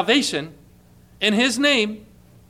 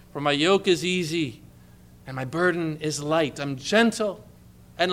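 soft and gentle; 4 semitones lower, 4 dB quieter than usual. Acted. Jesus' gentle invitation.

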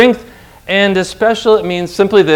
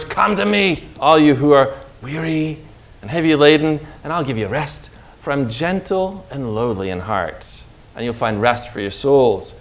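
soft, much quieter, tender call to the weary; a low, quiet, comforting invitation; gentle invitation; 4 semitones lower, 6 dB quieter than usual. Retold or imitated. Jesus' gentle call to sinners.

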